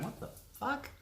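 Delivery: high, soft, groggy confusion; a mock bleary wake-up; half-asleep what the fuck. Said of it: "wake up half asleep going".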